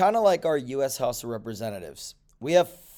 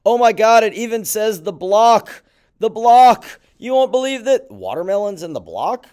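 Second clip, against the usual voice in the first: louder, higher, astonished; excited; 6 semitones higher, 10 dB louder than usual. Real, not acted. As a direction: marvel loudly at the news. Reading surprising article aloud.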